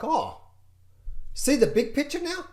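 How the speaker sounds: high, bright mock discovery; mock realization. Trader stepping back sees the big picture, high mock surprise. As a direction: act out the aha moment. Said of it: "you're like".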